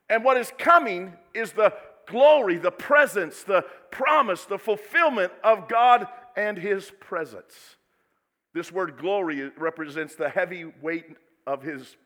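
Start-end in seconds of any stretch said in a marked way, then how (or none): 0.1-7.8 loud, higher, fervent build; full-voiced sermon climax; preaching. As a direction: preach it with rising force.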